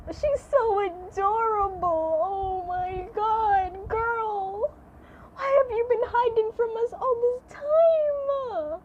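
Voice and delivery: slow, high coo building to a squeal; gushing, having a moment. Real, not acted. Streamer fawning over newly revealed Robin, gushing squeal. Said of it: "I'm having a moment".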